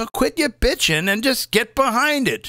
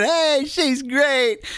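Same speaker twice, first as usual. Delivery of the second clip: slow, higher sarcastic gush; mock admiration; 6 semitones higher, much slower than usual. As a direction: drag it out like a smug fan. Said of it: "they'd have been sitting there saying".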